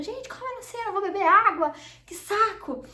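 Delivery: high-pitched exasperated grumbling; mock irritation. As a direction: act out a fed-up whine, pitch jumping up. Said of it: "eu ficava muito irritada".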